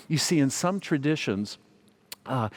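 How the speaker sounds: ordinary talk, nothing marked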